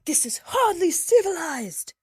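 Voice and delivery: high, loud indignant cry; outraged protest. Acted. Jackson to the narrator, shrieking in outrage. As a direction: shriek that this is uncivilized.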